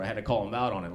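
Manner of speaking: usual speaking voice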